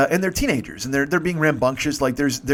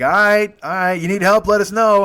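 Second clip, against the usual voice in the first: high, loud mock-friendly offer; loud jokey mock help; teasing young dudes; 6 semitones higher, 7 dB louder than usual. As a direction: egg him on, grinning. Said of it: "and they're like".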